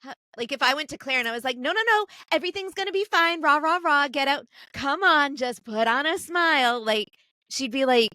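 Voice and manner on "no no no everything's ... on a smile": high-pitched mock pep talk; pushy put-on cheeriness, rallying someone who is down; forced cheerleading. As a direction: imitate an overbearing cheerer-up, pitched well up. Imitating forced cheering-up of a friend.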